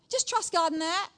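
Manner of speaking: loud, high, curt "Just trust God in that."; harsh. Shown as a demonstration. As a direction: snap it curtly. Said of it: "That's harsh".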